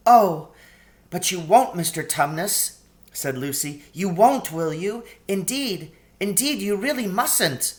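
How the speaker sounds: lightly voiced young girl, pleading; Lucy urges Mister Tumnus not to give her up. A narrator's reading. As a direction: gently beg him not to do it.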